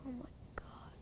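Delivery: breathless awed whisper; barely a breath of oh my God; hushed in disbelief. Real, not acted. Stunned again.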